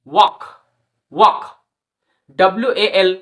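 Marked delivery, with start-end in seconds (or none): 0.1-1.4 ওয়াক with silent l, aw vowel then k; walk demo. Shown as a demonstration. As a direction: say ওয়াক twice, no l sound. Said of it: "W A এল K".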